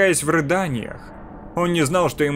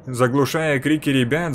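plain reading voice

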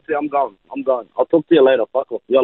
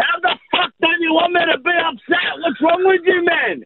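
higher, yelling in frustration; angry shout; 8 semitones higher than usual. Real, not acted. Husband yelling at wife he wants no more kids, angry higher shout. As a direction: vent at her, fed up.

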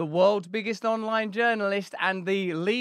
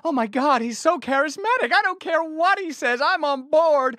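higher and louder mock swoon; swept-away gushing; 7 semitones higher, 5 dB louder than usual. Pretending to swoon over Putin, higher, louder mock gushing. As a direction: gush like a starstruck fan, hammed-up. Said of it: "I'm gonna get all swept".

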